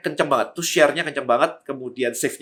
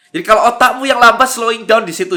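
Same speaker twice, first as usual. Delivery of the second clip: loud, higher-pitched comeback; emphatic retort; 8 semitones higher, 8 dB louder than usual. Real, not acted. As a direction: fire back at the critics, raised voice. Retorts to complaints about his speed.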